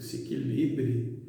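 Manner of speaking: usual speaking voice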